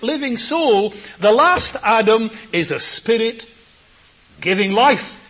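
high, loud fervent preaching; preaching at full force. Acted. Preacher booming about palm trees and Kidron, loud and high. Preacher builds on Adam and the last Adam.

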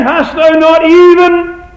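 high, loud, slow anguished cry; anguished Esau plea. Acted. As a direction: cry it out as a son begging his father. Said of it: "Read it again".

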